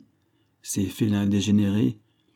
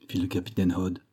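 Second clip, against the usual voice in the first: usual speaking voice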